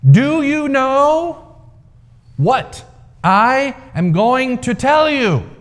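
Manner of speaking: high, slow, drawn-out booming question; the old man proclaiming, slow and grand; strong booming voice. A narrator's reading. Old man addressing the crowd from the rock, booming.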